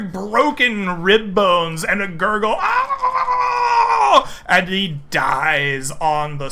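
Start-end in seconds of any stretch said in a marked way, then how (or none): 2.6-4.4 high sharp dying cry; death scream. Acted. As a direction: scream as the ribs break. Dying scream of struck enemy.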